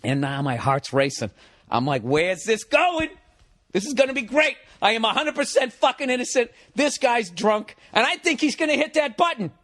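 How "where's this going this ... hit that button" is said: high-pitched, acted-out excitement; giddy anticipation. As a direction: relish the trouble that's coming. Retold racing-heart thoughts, eager for trouble.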